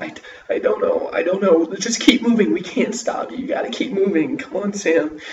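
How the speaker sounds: high, loud, breathless urgency; panicked urging. A narrator's reading. Big sister urging Sam to keep running, panicked. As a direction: push him on, terrified. Sister urges brother to flee.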